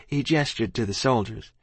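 usual speaking voice

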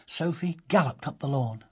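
ordinary delivery

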